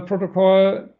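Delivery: normal talking voice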